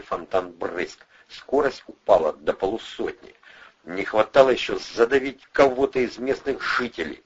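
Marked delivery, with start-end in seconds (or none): none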